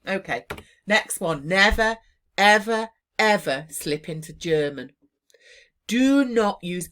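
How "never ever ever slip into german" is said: NEVER and ever hit loud and hard; emphatic warning. Real, not acted. Teacher warning exam students against German, emphatic. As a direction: hammer each word home.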